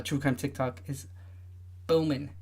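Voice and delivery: usual speaking voice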